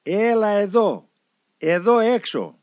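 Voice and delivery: high, loud, slow call from afar; a monk calling from his cave, slow and loud; sweet calling voice. Retold or imitated. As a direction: call him over, loud and drawn out. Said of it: "ακούω μια γλυκιά φωνή".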